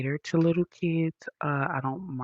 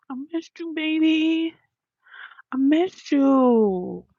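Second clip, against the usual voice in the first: very high, drawn-out cooing; 12 semitones higher, 5 dB louder, slower than usual. Real, not acted. Greeting Dex after an absence, high cooing baby talk. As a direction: coo it way up high, stretching the last words.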